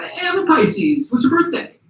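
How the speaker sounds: very high, eager imitated exclamation; excited, high-pitched retold greeting; excited discovery. Retold discovery of shared star sign.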